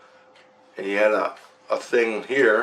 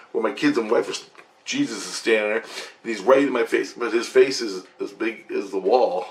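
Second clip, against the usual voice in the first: normal talking voice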